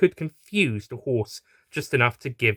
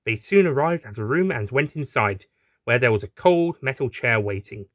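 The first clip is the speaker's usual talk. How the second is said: ordinary delivery, nothing marked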